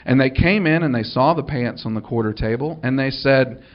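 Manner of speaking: ordinary delivery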